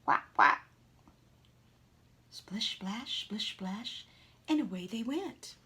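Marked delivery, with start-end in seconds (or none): 0.0-0.7 loud unvoiced quacks; mother duck saying goodbye. 2.3-4.0 soft whispered splish splash; whispered splashes. Acted. Ducks swimming away.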